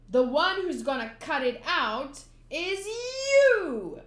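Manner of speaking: loud, slow, punched retort; angry retort. Acted. Nami retorting to the clown, loud and slow. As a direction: throw it back in his face.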